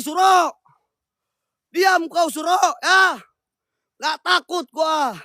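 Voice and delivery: high, forceful orders; commanding the ghost. Acted. Ghost hunter orders the pocong Suro to leave, high and forceful. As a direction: drive the spirit off with stern shouts.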